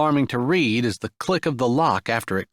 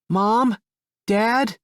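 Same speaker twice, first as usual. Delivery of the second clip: higher, louder call through a door; child calling; 10 semitones higher, 3 dB louder than usual. Acted. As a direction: call out to parents from outside. Child calls through locked door.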